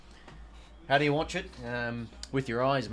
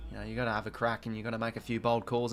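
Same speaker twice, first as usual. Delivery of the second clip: ordinary talk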